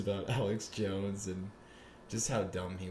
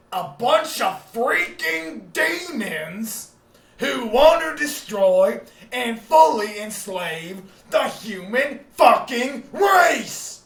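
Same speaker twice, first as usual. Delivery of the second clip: much higher, louder, slow furious ranting; raging rant; 14 dB louder, much slower than usual. Acted. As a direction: rant like a conspiracy radio host.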